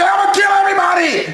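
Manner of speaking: mock killer's shout; crazy killer yell. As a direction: ham up a killer's rant. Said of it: "a crazy person is not gonna be".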